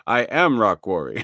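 deadpan wooden line; mock his lifeless voice acting; flat bad acting. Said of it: "That's what he's".

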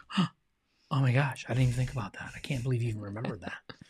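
hushed, fading to a whisper; awed whisper. Retold or imitated. Husband imitates his touched wife, softening into a whisper. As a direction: breathe it out, touched and amazed. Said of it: "she's like".

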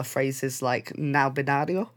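ordinary talk

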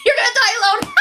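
high, loud mock-cruel shout; hammed-up villainous glee, very high; gleeful taunt. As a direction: burst out laughing as you taunt. Depression's sudden cackled taunt.

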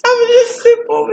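high whiny protest; high whine. Real, not acted. Friend teasing her.

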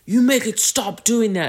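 high, loud confrontation; acted angry demand. Guy confronts Billy, high loud mock anger.

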